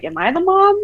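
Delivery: high, rising disbelief; retold bewildered question; incredulous question. Retells her bewildered reaction to mother.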